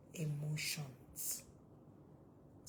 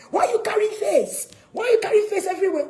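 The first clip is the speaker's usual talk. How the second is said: very loud, high scolding; exasperated parent's shouted demand; imitated angry parent; 12 semitones higher, 12 dB louder, faster than usual. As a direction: yell at a sulky teenager, acted out. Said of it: "You get angry that she's gloomy".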